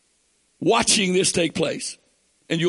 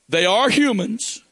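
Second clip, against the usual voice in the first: usual speaking voice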